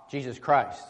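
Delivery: usual speaking voice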